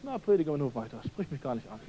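low, quiet grumble fading away; dismissive brush-off. Acted. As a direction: wave the preacher off under your breath. Voices the listener's indifferent brush-off. (leise abwinkend)

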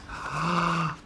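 soft surprised gasp; hushed dramatic gasp; storyteller gasp. Acted. Egg hatching in the story.

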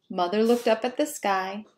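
ordinary delivery

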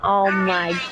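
ordinary talk, nothing marked

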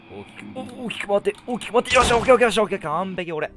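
high, loud triumphant cheer; excited cheering. Real, not acted. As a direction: pump yourself up as you escape. Dodging Mary, celebrating.

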